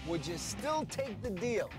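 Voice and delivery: ordinary talk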